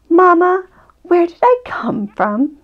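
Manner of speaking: lightly voiced little one asking Mama; curious little cub. Child character asks mother at bedtime.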